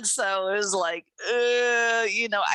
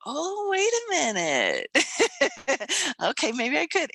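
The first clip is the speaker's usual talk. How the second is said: pitched-up wait-a-minute with a giggle; dawning realization; 7 semitones higher than usual. Retold or imitated. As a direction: catch yourself and reconsider with a laugh. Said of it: "and then I went".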